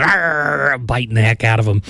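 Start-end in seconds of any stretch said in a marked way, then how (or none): 0.0-0.8 higher, drawn-out growl; playful attacking-dog noise; imitated dog growl. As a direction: mimic a dog latching on with a long "arrr". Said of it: "the dog latches onto his hand".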